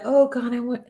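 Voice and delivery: mock timid, fading plea to God; a light parody of a weak prayer; mealy-mouthed prayer. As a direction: imitate a meek, hesitant asker. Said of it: "I'm not mealy mouthed like".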